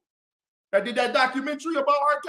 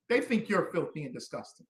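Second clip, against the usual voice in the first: ordinary delivery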